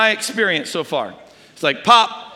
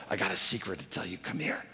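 much lower and much softer, hushed and quick; near whisper; 13 semitones lower, 14 dB quieter than usual. Retold or imitated. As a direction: breathe it like a secret close to his ear. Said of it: "I whisper into his ear something".

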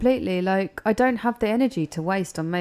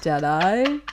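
loud silly character voice with rising endings; bad cross of Borat and a film simpleton; Forrest Gump; 5 dB louder, slower than usual. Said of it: "you're like a Borat Forrest Gump".

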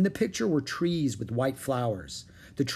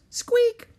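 very high, loud little squeak; quick high-pitched rodent peep; mouse squeak; 6 dB louder than usual. A narrator's reading. Narrator voices the mouse.

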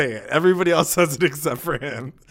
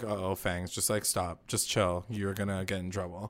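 quiet warning; 5 semitones lower, 9 dB quieter than usual. Retold or imitated. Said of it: "and I'm like".

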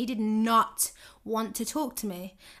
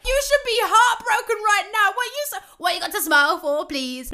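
indignant; 10 semitones higher, 7 dB louder than usual. Retold or imitated. Telling his happy posts off.

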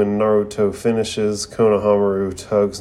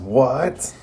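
retold yell; 4 semitones higher than usual. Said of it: "leans in and yells".

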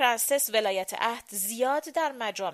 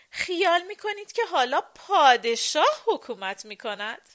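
raised, lively mocking question with a touch of amusement; amused; 6 semitones higher, 6 dB louder than usual. A narrator's reading. Madame to the ladies about who rules, amused. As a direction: scoff lightly, higher and louder. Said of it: "با خنده گفت".

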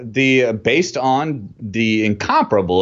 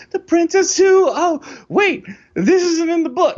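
exaggerated cartoon intonation. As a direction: squeak it out like a cartoon kid. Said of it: "keeps, like, going".

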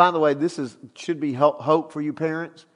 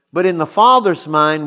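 ordinary talk, nothing marked

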